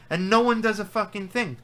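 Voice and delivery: furious pundit venting about robbed fighters; exasperated outburst. Real, not acted. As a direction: vent it like nobody ever listens. Furious rant about judging.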